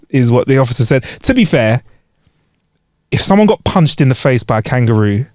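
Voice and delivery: ordinary delivery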